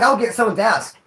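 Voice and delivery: ordinary delivery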